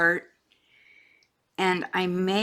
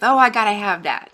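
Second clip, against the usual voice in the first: loud burst of self-quoted eagerness; exaggerated, loud must-have exclamation; mock craving; 3 semitones higher, 10 dB louder than usual. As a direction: blurt it like an impulse shopper. Quotes own shopping urge.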